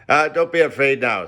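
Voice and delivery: grinning landlord. A narrator's reading. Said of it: "said he, grinning again".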